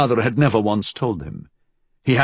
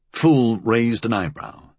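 ordinary delivery

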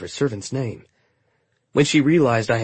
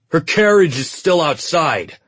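suspicious soldier; 5 semitones higher, 7 dB louder, slower than usual. A narrator's reading. Soldier challenges Trina.

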